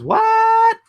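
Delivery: very high, loud, drawn-out squeal; exaggerated falsetto "huh?!"; squealed disbelief. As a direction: shoot way up into a mock-incredulous squeal. Mock disbelief, reading a comment.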